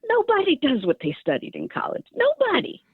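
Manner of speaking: lightly voiced Southern father, amused and reassuring; a fond Southern dad telling his daughter not to worry; dad's Southern accent. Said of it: "he had this Southern accent".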